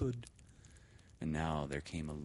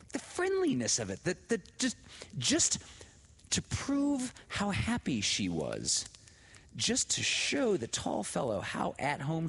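excited; 10 semitones higher, 3 dB louder than usual. A narrator's reading. Laura's excited thought.

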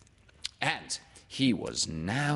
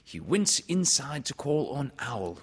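plain reading voice

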